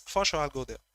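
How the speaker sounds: usual speaking voice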